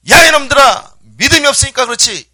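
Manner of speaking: very loud, high-pitched acted rebuke; scolding shout. Jesus telling off disciples for lacking faith, angry shout. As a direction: bellow it at the culprits.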